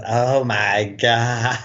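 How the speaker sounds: very slow, drawn-out mock awe; unsexy mock swoon. Mocking her reaction in the love scene, drawn-out fake swoon. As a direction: stretch it out as a hammed-up reaction. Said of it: "it's so unsexy. At first, her, her reaction".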